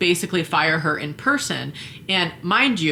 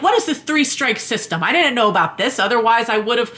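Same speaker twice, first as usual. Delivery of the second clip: mock indignant volunteer; 6 semitones higher, 3 dB louder than usual. Imitating volunteer's complaint.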